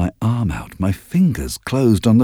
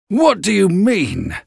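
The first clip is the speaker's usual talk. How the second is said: startled man; 11 semitones higher, slower than usual. A narrator's reading. Startled Heathcliff questions narrator.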